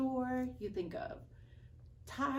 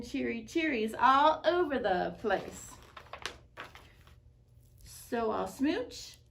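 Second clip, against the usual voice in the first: louder, delighted fish chanting his new refrain; a formerly glum fish now joyful; cheery fish; 6 dB louder than usual. Acted. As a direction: beam it out like a fish just kissed. Fish's happy new refrain.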